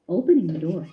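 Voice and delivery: ordinary delivery, nothing marked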